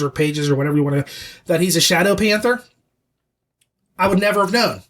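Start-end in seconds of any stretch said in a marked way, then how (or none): none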